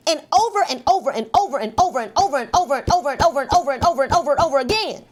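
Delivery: racing through "over and over" in one breath; rapid-fire repetition. Acted. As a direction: rattle off every "over" very fast, like it never ends. Exaggerating endless repetition.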